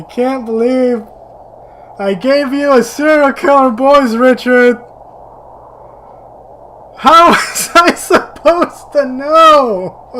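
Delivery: loud, high, incredulous; can't believe you fell for it; loud high laughing disbelief. Real, not acted. Laughing disbelief at game twist.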